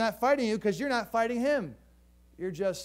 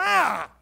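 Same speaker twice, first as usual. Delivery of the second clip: mock cry of pain; 6 semitones higher, 8 dB louder than usual. Foot crushed against wall.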